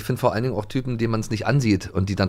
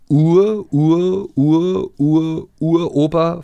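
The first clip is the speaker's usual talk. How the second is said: higher, louder stammered Ur, Ur with slow finish; mock-stuttering; 7 semitones higher, 6 dB louder, much slower than usual. Reading the silly quiz answer aloud, stammering. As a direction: stammer the Ur syllables, amused.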